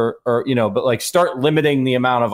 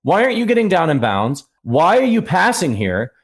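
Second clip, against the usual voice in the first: higher-pitched incredulous questions; exasperated fan questioning the coaches; exasperated disbelief; 5 semitones higher than usual. Retold or imitated. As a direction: demand an answer in disbelief. Acting out disbelief at play calls.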